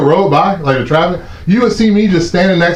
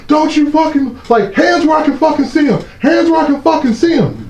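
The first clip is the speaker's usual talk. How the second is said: higher pitched barking cop, imitating the order; hammed-up trooper shouting hands up; mock cop yell; 13 semitones higher than usual. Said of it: "And they're yelling at me".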